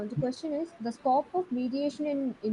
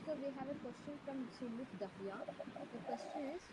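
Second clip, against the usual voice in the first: very faint, distant voice; barely audible; 16 dB quieter than usual. Real, not acted. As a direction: speak barely above nothing. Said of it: "but your voice is very low".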